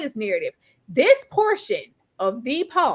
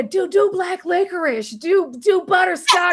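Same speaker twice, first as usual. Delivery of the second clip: higher, slower teasing list; mock sing-song listing; 5 semitones higher than usual. Mocking the vampire naming his songs, high and slow. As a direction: tease out each song name.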